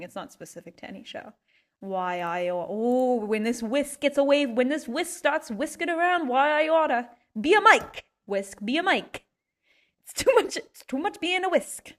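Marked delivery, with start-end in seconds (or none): 1.8-7.1 mock threat. Threatening the whisk. 7.4-11.9 high loud mock wail. Pleading with whisk.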